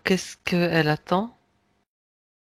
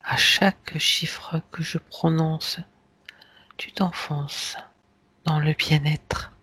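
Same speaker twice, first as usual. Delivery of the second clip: hypnotic induction; 3 semitones lower, slower than usual. Acted. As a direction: lull them down into deep relaxation.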